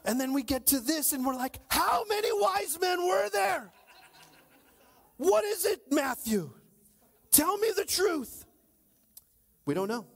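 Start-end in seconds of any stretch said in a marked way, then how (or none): 1.7-8.4 high, loud pleading shout at Matthew; mock plea to Matthew. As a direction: cry out to Matthew for the truth. Preacher voicing his plea to Matthew.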